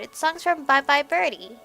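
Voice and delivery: ordinary talk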